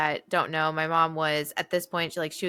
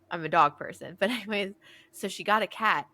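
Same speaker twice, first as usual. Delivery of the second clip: ordinary delivery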